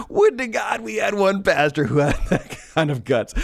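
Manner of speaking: high, amused, talking through laughter; laughing. Real, not acted. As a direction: let the laugh break into the words. Still laughing at the fat cows.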